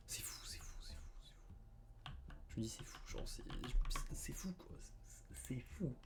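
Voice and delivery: soft breathless whisper of disbelief. Real, not acted. Telling viewers how happy he is, whispered disbelief. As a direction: whisper it to yourself, stunned.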